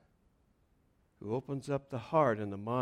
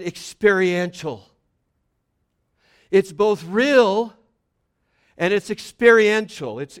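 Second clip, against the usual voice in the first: louder, higher, building preacher's cry; fiery preaching; 5 semitones higher, 8 dB louder than usual. Acted. Pastor urging the congregation, fervent preaching. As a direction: preach it out with rising fervour.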